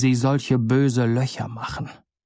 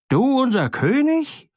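much higher indignant outcry; even angrier birds; 12 semitones higher than usual. A narrator's reading. The birds shout at the little bird, even angrier and much higher. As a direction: hurl it back in outrage. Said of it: "riefen die Vögel noch zorniger".

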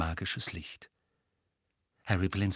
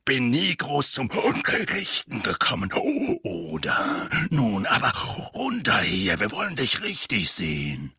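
put-on gruff old veteran; 4 dB louder than usual. Moody keeps talking.

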